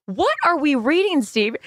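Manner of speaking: high, alarmed question to the dad; a playful panicked check; mock alarmed. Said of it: "And I'm like".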